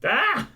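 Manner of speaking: high startled yelp. Real, not acted. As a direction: yelp at the jump scare. Jump scare in clip.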